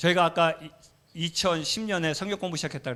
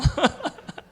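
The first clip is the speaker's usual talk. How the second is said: 웃음; 12 semitones higher, 5 dB louder than usual. Real, not acted. 소리치려던 자신을 웃음.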